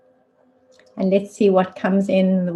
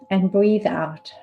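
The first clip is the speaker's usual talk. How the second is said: unhurried meditation guide, long pauses between phrases; spacious, measured body-awareness guidance; guided meditation. Real, not acted. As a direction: lead them gently into stillness, leaving space after each instruction.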